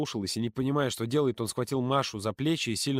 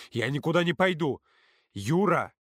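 raised, higher-pitched protest; emphatic, higher protest; heated refusal; 5 semitones higher, 4 dB louder than usual. A narrator's reading. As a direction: refuse outright, voice lifted.

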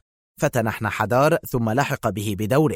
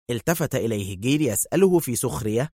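plain reading voice

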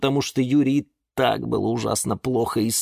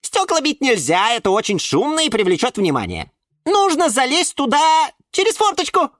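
very high little squeak; squeaky violet; 4 dB louder than usual. A narrator's reading. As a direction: squeak out the sensible advice. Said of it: "пискнула фиалка Наталка". (тоненький писклявый голосок)

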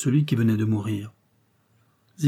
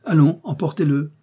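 impatient, louder and higher command; brisk order; 5 semitones higher, 5 dB louder than usual. A narrator's reading. Detective hurrying his men along, brisk and commanding. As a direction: press the men to get moving. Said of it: "ordonna vivement Paulin Broquet".

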